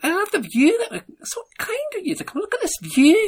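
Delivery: very high, breathy-free squeaky Scottish TV host; Lorraine Kelly impression. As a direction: squeak it out like a chirpy breakfast presenter. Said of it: "I was trying to be Scottish".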